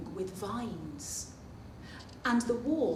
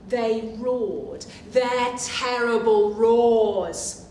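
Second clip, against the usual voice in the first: louder and slowed for the roar; dramatic loud narration; 10 dB louder, much slower than usual. A narrator's reading. Reader acting out monsters for children, louder and slow. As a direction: boom it out slowly to thrill the kids.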